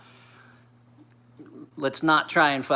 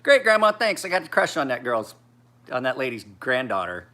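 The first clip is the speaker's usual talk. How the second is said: put-on sarcastic kid; 2 semitones higher, 5 dB louder than usual. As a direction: thank grandma with biting irony. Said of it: "sarcastic to grandma".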